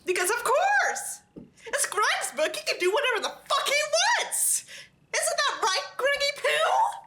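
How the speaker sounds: very high, squeaky, sarcastic ranting with a laugh and a whimper; exasperated mock rant. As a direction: shriek at the author in mock despair, stretching out Greggy Poo. Ranting at the author Greg.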